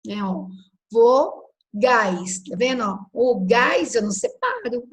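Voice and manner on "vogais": "vogais" split as vo, gais with a pause; syllable by syllable. Shown as a demonstration. Teacher showing what stays together, syllable-by-syllable. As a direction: say "vogais" slowly in two separate chunks. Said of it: "eu tenho que separar".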